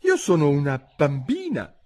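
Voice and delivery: lightly voiced hesitant girl; rather doubtful. A narrator's reading. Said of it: "piuttosto dubbiosa".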